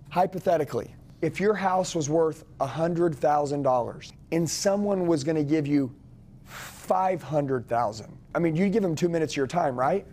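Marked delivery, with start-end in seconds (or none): 0.1-8.2 slowing down, dropping on the big number; slow deliberate pitch. Shown as a demonstration. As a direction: let the numbers land slowly. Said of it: "that's what closers do".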